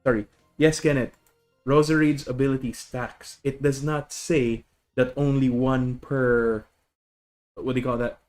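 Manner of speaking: usual speaking voice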